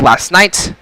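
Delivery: ordinary talk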